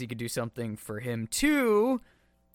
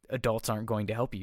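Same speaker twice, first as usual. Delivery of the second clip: usual speaking voice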